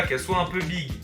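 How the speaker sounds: usual speaking voice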